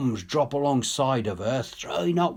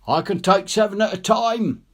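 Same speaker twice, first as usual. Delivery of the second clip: higher and louder, calling across the water; 8 semitones higher, 6 dB louder than usual. Acted. Rescuer calls to stranded soldiers from his boat, loud call. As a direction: sing it out to the men on the beach. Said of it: "he sings out when he's in close".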